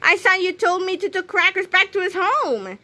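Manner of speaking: high, accusing complaint; indignant protest. A narrator's reading. George confronting Harold about Crackers, indignant protest.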